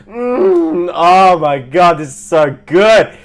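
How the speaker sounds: loud, slow, high moaning over the food; hammed-up food moaning. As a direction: moan like the food is heavenly. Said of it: "we started moaning".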